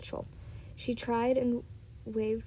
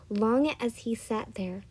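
usual speaking voice

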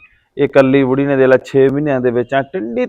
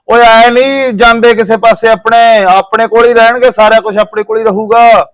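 high, raised-voice mimicry of a loud boaster; mock loud declaration, held high; imitated loud talking; 8 semitones higher, 4 dB louder than usual. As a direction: retell his rant pitched way up. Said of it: "ਉੱਚੀ ਉੱਚੀ ਬੋਲੇ".

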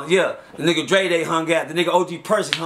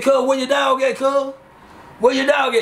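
mock street demand; 6 semitones higher than usual. Rivals demanding the dog.

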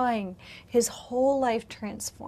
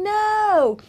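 high, louder cried no; mock scream; 10 semitones higher, 7 dB louder than usual. Preacher reliving screaming no in church, a louder cry. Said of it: "And I'm screaming".

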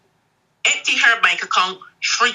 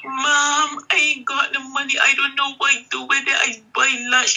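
higher, whiny daughter pleading through fake tears; mock crying daughter; 7 semitones higher than usual. Daughter pleads with mom about missing money, mock tearful. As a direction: imitate crocodile tears, pitched up. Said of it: "She started to cry".

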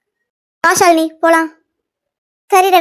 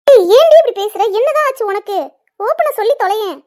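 exasperated demand; 3 semitones higher, 6 dB louder than usual. Acted. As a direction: demand an answer, fed up. Exasperated friend demands an answer.

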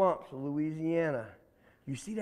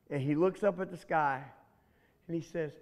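usual speaking voice

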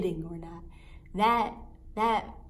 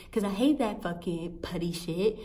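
usual speaking voice

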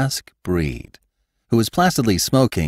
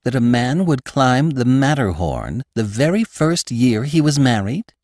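a curious wife asking, a touch higher; wife's voice; 7 semitones higher, 3 dB louder than usual. Acted. Wife's questioning line voiced.

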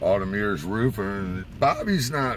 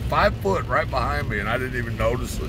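ordinary talk, nothing marked

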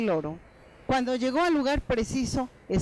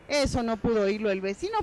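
normal talking voice

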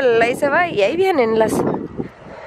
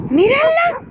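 surprised call; 13 semitones higher, slower than usual. Real, not acted. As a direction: point her out, amazed. La perrita se metió al agua. (exclamación sorprendida)

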